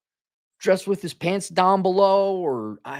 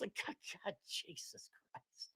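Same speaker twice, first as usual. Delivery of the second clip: whispered, exasperated; breathy aside; 3 semitones higher, 25 dB quieter than usual. Real, not acted. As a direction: breathe it out in disbelief.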